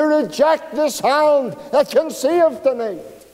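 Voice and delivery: impassioned altar call, every phrase pushed out; preaching at full force. Real, not acted. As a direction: press the sinner hard with each question.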